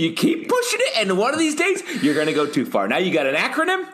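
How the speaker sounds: high-pitched, playful telling-off with laughter in it; mock scolding. Teasing a co-host over a made-up acronym, high mock scolding.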